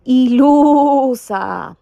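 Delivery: loud, slow, drawn-out insult; loud scornful taunt. Acted. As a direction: sneer it loudly and slowly. Sheep insults another sheep.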